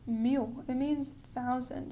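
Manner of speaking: normal talking voice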